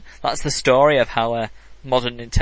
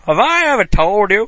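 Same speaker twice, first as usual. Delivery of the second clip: mock Texas accent; 6 semitones higher, 5 dB louder than usual. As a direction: echo the Texas grandpa voice lightly. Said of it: "Nice Texas accent".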